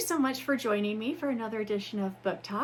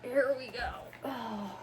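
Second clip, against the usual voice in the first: ordinary talk, nothing marked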